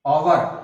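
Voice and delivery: ओवर said alone, starting on the vowel without h; silent h. Shown as a demonstration. Teacher repeats the correct word for students, ओवर without h. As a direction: model ओवर as "our", h left silent. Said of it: "क्या कहेंगे हम?".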